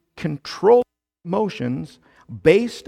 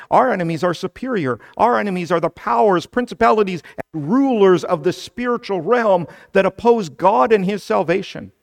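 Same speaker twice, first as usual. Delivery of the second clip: louder, higher, driving sermon delivery; forceful preaching; 4 semitones higher, 6 dB louder than usual. Real, not acted. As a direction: preach it hard, listing each enemy with force.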